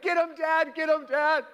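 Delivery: put-on high, loud kid-style egging on; mock-frantic calling out; imitated urging shout. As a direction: cheer Dad on from a safe distance. Kids egg dad on from doorway.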